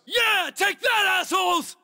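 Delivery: high, drawn-out gloating cheer; triumphant yell. Acted. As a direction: yell it out as the enemy goes down. Cheering as enemy is hit.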